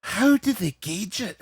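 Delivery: slow, high Scottish comic disbelief; Billy Connolly. Acted. Comedian questioning how pain is measured, Scottish impression. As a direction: ask it slowly as a baffled Scottish stand-up. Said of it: "Billy Connolly talk about the stonefish".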